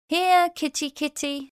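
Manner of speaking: high sing-song call to a kitty; calling a cat. Shown as a demonstration.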